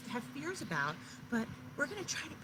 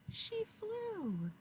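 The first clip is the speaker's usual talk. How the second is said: plain reading voice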